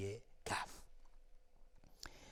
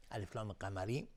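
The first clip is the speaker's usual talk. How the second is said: ordinary talk, nothing marked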